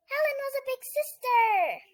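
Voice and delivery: high, loud surprise announcement; excited reveal. Acted. Kid telling viewers about the new baby sister, high and excited. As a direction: burst out with the big news.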